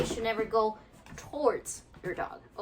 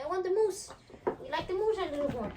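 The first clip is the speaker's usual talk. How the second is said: voicing the dog; 10 semitones higher than usual. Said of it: "she's like".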